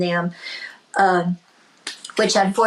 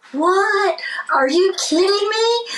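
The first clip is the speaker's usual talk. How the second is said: high, stunned "What? Are you kidding me?"; shocked disbelief; 10 semitones higher, slower than usual. Retold or imitated. Singer hearing a guitar is hers, stunned and high. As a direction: gasp it out in disbelief.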